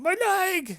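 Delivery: high, loud cry of pain; high pained wail; wailing halfling. Acted. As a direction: wail it as you're dragged off. Injured halfling dragged out wailing.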